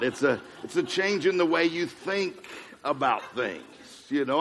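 normal talking voice

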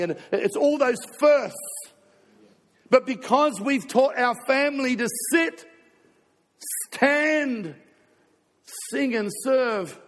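fervent sermon peak, pitched high; impassioned preaching. Real, not acted. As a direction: build to a ringing proclamation. Preacher declares family's resolve, rising.

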